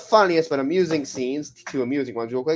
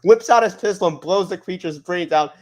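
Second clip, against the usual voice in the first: ordinary talk, nothing marked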